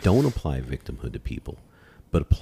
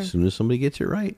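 higher, faster teasing repeat; teasing singsong; 8 semitones higher, faster than usual. Real, not acted. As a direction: tease them with a sing-song lilt. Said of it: "repeats it teasingly higher".

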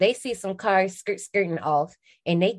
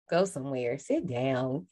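low, softer put-down; mock dismissal; 5 semitones lower, 5 dB quieter than usual. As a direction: wave someone off with mock authority. Mocking her bossy younger self.

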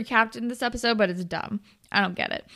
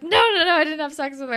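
imitating a quick, raised-pitch protest; hurried denial; 4 semitones higher, faster than usual. Drew denies it to his questioning girlfriend, hurried denial. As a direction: rush out a mock defensive denial.

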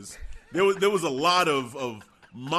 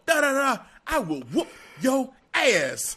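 mock yell; 7 semitones higher than usual. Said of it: "He was like".

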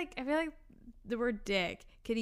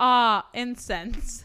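slow loud savouring sigh; drawn-out bliss; 8 dB louder, much slower than usual. Retold or imitated. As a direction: stretch it out in bliss. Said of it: "my shit should be screaming".

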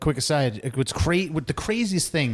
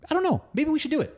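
much higher mock pundit voice; 13 semitones higher, much faster than usual. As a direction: ham up a dithering warmonger. Mocking State Department guest.

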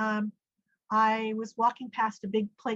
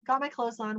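ordinary talk

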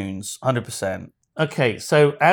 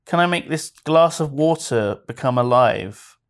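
ordinary delivery

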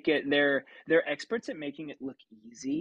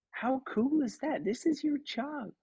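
high, gushing impression of a delighted star; delighted Kate McKinnon; 12 semitones higher than usual. Kate McKinnon gushing high and delighted at the new puppeteer. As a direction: gush it high like a thrilled celebrity. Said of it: "and she goes".

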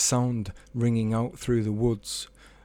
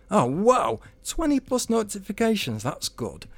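high, delighted gasp at the news; thrilled and a little amazed; bright-eyed delight at the notifications; excited Tony; 10 semitones higher than usual. A narrator's reading. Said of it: "thought Tony".

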